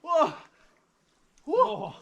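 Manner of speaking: high gasping whoa; breathless fright. Real, not acted. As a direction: gasp from the icy water. Cold water dunk.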